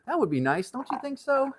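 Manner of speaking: rising to a very high pitch; playful high voice. Pretend. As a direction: lift into a squeaky-high playful question. Playfully asks in put-on high voice.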